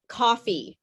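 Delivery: "Coffee" with its last vowel a full ee; full final E. Shown as a demonstration. Teacher corrects the student, "Coffee" with a full ee ending. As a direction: fully sound the ee ending "Coffee". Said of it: "fully pronounce that E".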